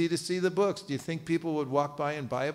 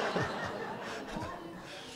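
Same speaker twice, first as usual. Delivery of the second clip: audience laughter; 14 semitones higher, 9 dB quieter than usual. Real, not acted.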